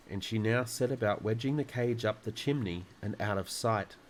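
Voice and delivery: plain reading voice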